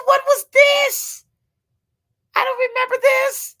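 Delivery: very high, loud disbelief; re-enacted shock at an unexpected windfall; astonished. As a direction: cry out in amazed confusion. Retells getting a surprise check.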